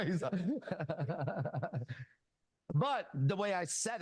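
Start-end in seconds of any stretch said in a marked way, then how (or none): 0.4-2.0 long run of chuckling; laughter. Real, not acted. Laughing after retold story moment.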